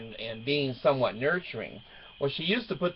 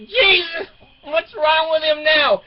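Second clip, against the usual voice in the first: high, loud, wailing complaint; imitated exasperated daughter; 8 dB louder, slower than usual. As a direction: shout it like a fed-up teen, pitched way up.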